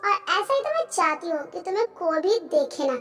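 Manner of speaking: hushed self-congratulating aside; talking to herself. Acted. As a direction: think it aloud under your breath. Character's scheming thoughts to herself.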